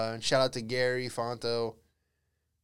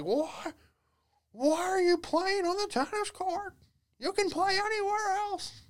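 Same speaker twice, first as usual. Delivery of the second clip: put-on much higher, slow grumpy old-guy voice; bitter old man. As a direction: whine it like a cranky old neighbour. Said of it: "the old country club guy".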